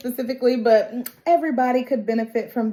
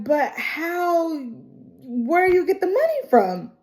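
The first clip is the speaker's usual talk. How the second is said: puzzled disbelief; 7 semitones higher than usual. Retold or imitated. Team questioning Moto.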